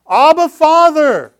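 high, loud, slow cry to God. Shown as a demonstration. Preacher demonstrating the loud cry to the church, shouting. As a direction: cry out with all your heart. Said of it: "It's a loud cry".

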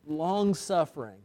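normal talking voice

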